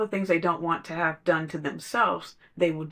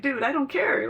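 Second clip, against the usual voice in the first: blunt dismissal; 8 semitones higher than usual. Retold or imitated. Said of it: "I'm just like".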